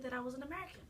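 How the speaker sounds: British speaker doing understated American accent. Shown as a demonstration. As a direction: slip quietly into an American accent. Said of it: "Your American is way better".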